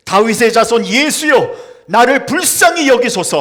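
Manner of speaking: loud crying out for mercy. Acted. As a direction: call out to Jesus, loud and pleading. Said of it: "소리를 질러 이르되". (자비를 구하며 크게 외치는 소리)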